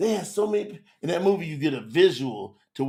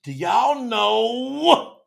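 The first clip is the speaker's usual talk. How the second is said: ordinary talk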